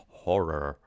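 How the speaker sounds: "Horror" with heavy rolled American R's. Shown as a demonstration. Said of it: "the R's are so crazy".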